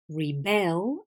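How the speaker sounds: reBEL, second syllable long and stronger; stress on second syllable. Shown as a demonstration. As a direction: say rebel as a verb, ri-BEL. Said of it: "the stress changes".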